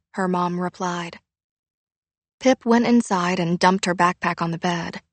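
ordinary delivery

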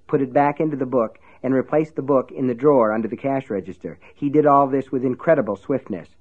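ordinary delivery

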